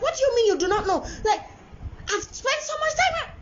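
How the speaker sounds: put-on high, incredulous outburst at a child; acting out a fed-up parent; exasperated mother. Acted quarrel, mother to son.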